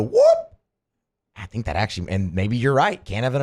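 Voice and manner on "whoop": a sudden high, loud whoop like a cheering crowd; yell leader whoop. Retold or imitated. Imitating a Yell Leader at a game, loud high whoop. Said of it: "be able to go".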